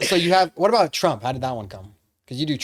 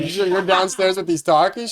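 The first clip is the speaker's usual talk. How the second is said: usual speaking voice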